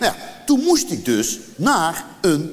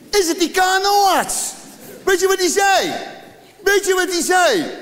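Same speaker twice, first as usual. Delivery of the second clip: excited buildup; 11 semitones higher than usual. Real, not acted. Comedian builds suspense for the audience, excited. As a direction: hype the crowd up.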